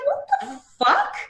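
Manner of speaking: high, stunned disbelief, drawn out slowly; inner what the fuck. Retold or imitated. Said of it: "I'm like".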